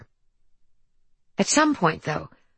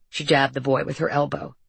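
ordinary delivery, nothing marked

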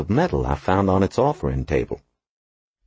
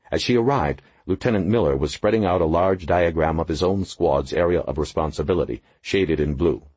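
ordinary delivery, nothing marked

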